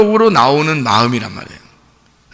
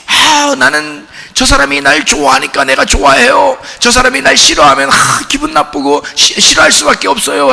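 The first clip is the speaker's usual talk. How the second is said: higher, put-on complaining voice; whiny ordinary person; 6 semitones higher than usual. A self-centred person explaining their grudges, higher and whiny. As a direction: play the petty human who only loves back. Preacher voices typical sinful human.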